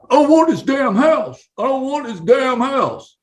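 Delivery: high-pitched mock buyer outburst; complaining buyer. Imagined buyer complaining at closing table.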